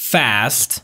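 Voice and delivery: American "fast" with a front a as in cat; American accent, flat front vowel in "fast"; American pronunciation. Shown as a demonstration. English teacher contrasting it with British, American accent. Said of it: "بریتیش".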